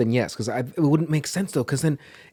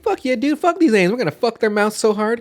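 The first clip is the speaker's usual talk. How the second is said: hyped-up soldier; 8 semitones higher than usual. Retold or imitated. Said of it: "We're like".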